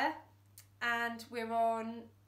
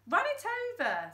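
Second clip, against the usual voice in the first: much higher and louder; callous order; 12 semitones higher, 7 dB louder than usual. Acted. Fingers telling Dad to hit the pig, callous. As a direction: order it with no mercy. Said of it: "said Fingers".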